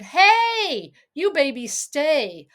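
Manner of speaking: high, loud call to the babies; shouting after crawling babies, slow on stay; hollering. Acted. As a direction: holler at them to stay put. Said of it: "You hollered".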